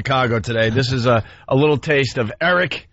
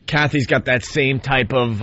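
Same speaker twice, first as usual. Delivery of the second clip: normal talking voice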